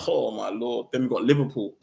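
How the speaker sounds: ordinary talk, nothing marked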